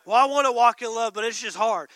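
loud mock complaint from a struggling believer; whiny believer. Preacher mimicking a congregant, loud mock whine. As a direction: whine that it's too hard.

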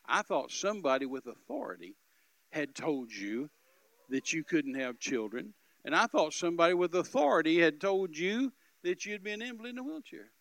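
loud, building, preacherly declaration; re-enacted loud, emphatic explanation; emphatic relief. Acted dismissal of doctors' verdict.

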